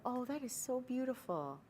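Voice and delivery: the right way, gentle and impressed; hushed admiring compliment. Shown as a demonstration. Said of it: "No, this is the".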